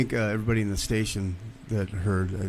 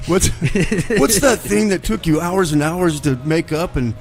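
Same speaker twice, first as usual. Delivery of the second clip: excited; 6 semitones higher, 5 dB louder than usual. Real, not acted. Excited laughing prompt.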